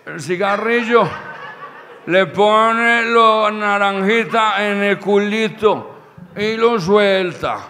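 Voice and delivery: put-on loud, dragging drunken instructions; imitating a plastered old man; drunk dad. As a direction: stretch the words like a tipsy father lecturing. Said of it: "todo chapeto".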